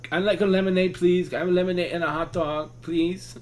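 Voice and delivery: put-on high, polite, childlike request; childlike ordering voice. At the concession stand, a high childlike order. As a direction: order like a shy overgrown kid. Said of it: "I was that guy till we got to our fucking seats".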